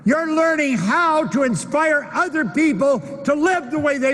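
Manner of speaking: high-pitched, fervent motivational oratory; impassioned preaching. Acted. Speaker telling audience what learning really is, impassioned preaching. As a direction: preach it high and urgent, driving each point home.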